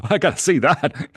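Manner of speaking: ordinary talk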